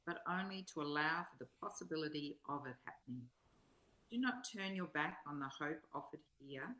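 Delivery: measured, solemn reading of a spiritual text; inspirational reading. Acted. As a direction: read each line as if offering it to the listener. Reads a spiritual text aloud.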